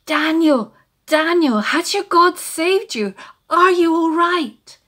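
calling loud and high down into the pit; worried king calling loud down to Daniel; shouting down. Acted. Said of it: "he shouted down into the pit".

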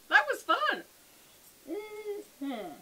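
giddy outburst; excited. Real, not acted. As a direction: burst out in delight. Loud excited reaction.

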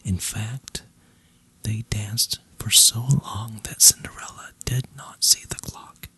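ordinary delivery

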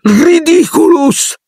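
high-pitched drawn-out cry; shrieked spell. A narrator's reading. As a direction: stammer the first sound, then shriek it high. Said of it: "strillò Neville". (incantesimo strillato)

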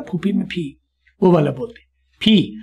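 «फी» with an aspirated ph, as in «फूफी»; breathy p sound of «फूफी» for «फी»; phi as in phuphi. Shown as a demonstration. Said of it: "फूफी में जो फी होता है ना".

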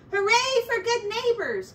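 very high, loud hooray; joyful cheer. A narrator's reading. Ratty cheers at the cheese, overjoyed. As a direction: cheer with delight. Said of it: "cried Ratty".

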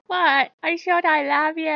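high, loud imitated whine; mock protest. Loki defending himself to annoyed player, mock protest. Mimics Loki's defensive reply.

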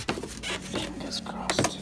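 slow, voiceless whisper; whispered hope. Real, not acted. Man hoping his new barbecue is fine, slow whisper.

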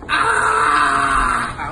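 loud cry after the shot; loud shout. Acted. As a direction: yell as the shot burns. Shot hits, partying.

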